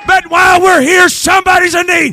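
rising, shouted revival preaching; preaching at full force. Acted. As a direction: drive the congregation with a loud, building sermon.

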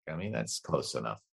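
normal talking voice

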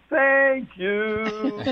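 high, drawn-out mock-sweet thank you; comically sustained, singsong gratitude; exaggerated thanks. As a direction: stretch it out high, as if thanking someone who wronged you. Thanking after stubbing a toe.

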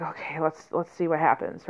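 quiet, hushed okay, let's see; softly voiced classroom aside. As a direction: drop to a hush so the kids can focus. Hushed aside while kids close eyes.

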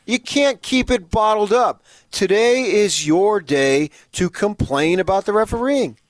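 slow, loud and high declaration; slow emphatic shout. Real, not acted. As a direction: proclaim it slowly at full volume. Insisting it's complain day.

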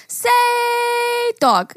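drawn-out "say", mock child; little girl. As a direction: coax it out like a little kid. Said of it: "And she's like".

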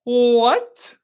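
high, drawn-out, hammed-up disbelief; Shannon's shocked reaction. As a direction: stretch it into a high rising gasp of a question. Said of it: "Yeah, it's like this. It's like".

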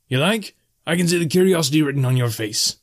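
high, lilting salesman-innkeeper; high-voiced barkeep with wares to sell; barkeep making an offer. A narrator's reading. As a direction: tempt the guest, pitched well up. Bartender pitches his wares to guest.

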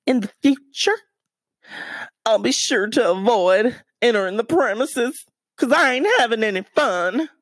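high twangy southern accent, hammed up. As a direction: put on a big southern accent like Thelma and Louise. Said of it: "I should have said it in a southern accent".

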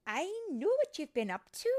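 high, loud reproach; disapproving scolding. Acted. Fairy Mary confronting Tink in workshop, disapproving. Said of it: "shaking her head".

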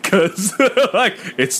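laughing through words. Real, not acted. Laughing while quoting the dad.

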